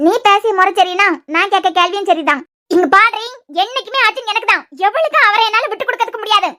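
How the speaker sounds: usual speaking voice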